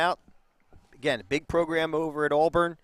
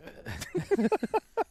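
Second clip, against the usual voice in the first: high laugh; 14 semitones higher than usual. Real, not acted. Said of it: "I'm laughing".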